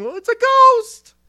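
high, loud, slow spooked cry; hammed-up terrified disciple; frightened cry. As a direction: shriek it like you've seen a ghost.

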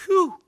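high, loud "whew"; exaggerated high whew of relief. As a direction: blow out a big mock sigh of relief. Mock relief as Moses.

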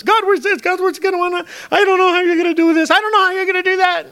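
very high, fretful mock whine; hammed-up high-pitched fretting; worried panic. Acting out faithless, worried believer.

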